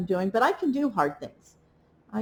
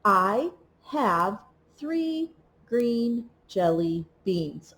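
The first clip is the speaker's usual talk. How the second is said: slow and even, one word per tap; slow word-by-word reading; 2 semitones lower, much slower than usual. Shown as a demonstration. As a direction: read it slowly, tapping each word for a child. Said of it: "tap the words as we read them".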